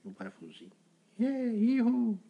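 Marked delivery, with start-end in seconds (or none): 1.2-2.2 put-on high, loud, slow child's play cheer; mock playtime yell; playful whoop. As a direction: cheer like a little boy playing with a toy. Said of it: "Aí ele fazia de hominho".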